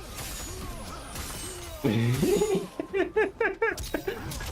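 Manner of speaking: long, high-pitched laughing fit; high giggles running on and on. Real, not acted. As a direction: crack up helplessly. Cracks up at own question.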